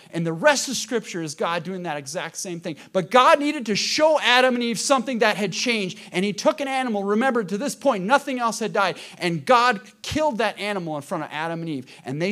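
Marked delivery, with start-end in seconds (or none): none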